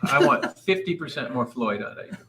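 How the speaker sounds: ordinary talk, nothing marked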